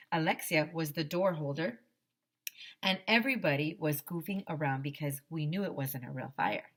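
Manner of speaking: plain reading voice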